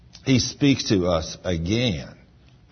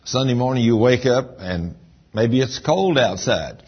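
ordinary talk, nothing marked

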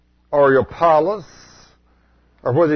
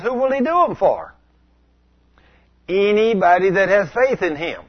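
fervent preaching; 7 semitones higher than usual. Acted. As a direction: preach it with fervour to the church. Preaching at full force.

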